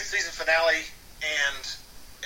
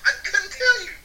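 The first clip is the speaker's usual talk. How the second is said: high, laughing self-defense; comically high-pitched excuse; mock protest. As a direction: plead helplessly through a laugh. Pleading while being hit, retold.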